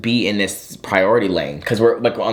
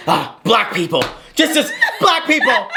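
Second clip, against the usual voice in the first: laughing, high mock disgust; hammed-up exasperation; imitated exasperated stranger; 12 semitones higher than usual. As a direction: act out a fed-up bystander through laughter. Imagined thoughts of annoyed man.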